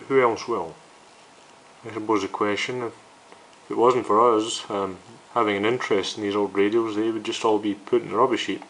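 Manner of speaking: ordinary delivery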